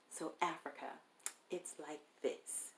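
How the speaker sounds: a hint of a condescending producer; imitating the condescending producer. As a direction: spell it out like a patronizing boss talking down. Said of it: "this is how he's talking to me".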